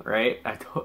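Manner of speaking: ordinary talk, nothing marked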